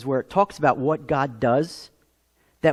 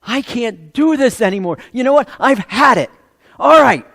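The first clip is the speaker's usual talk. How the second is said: louder and higher, building to a loud snap; exasperated outburst growing to a shout; fed up; 5 semitones higher, 8 dB louder than usual. Retold or imitated. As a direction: throw your hands up at the end of your rope. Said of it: "you're just like".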